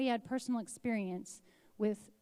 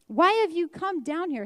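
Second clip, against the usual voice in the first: higher and much louder, a furious demand; angry older brother; 7 semitones higher, 9 dB louder than usual. Acted. Eliab confronting David on the battlefield, angry and loud. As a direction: bark it at the little brother. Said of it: "His anger burned against David".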